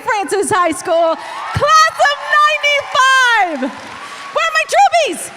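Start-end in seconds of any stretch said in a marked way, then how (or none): 1.5-3.6 high, loud rally call; crowd hype. Real, not acted. Hyping alumni crowd. 4.3-5.3 high, loud call stressing troopies. Real, not acted. Calling out to crowd.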